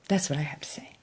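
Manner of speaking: a soft unvoiced whisper; confiding whisper to viewers; whispered aside. Real, not acted. Whispered aside after praise.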